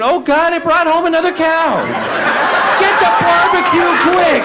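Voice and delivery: high, bright panic turning to glee; alarmed then excited. Retold or imitated. Neighbor finding the cat's cow on Sunday morning, alarmed. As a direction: gasp at the cow, then call for the barbecue.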